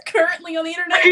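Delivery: real tears breaking through with a laugh; tearing up. Real, not acted. Sharing her found family story on a live show, tearful. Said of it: "You're crying then?".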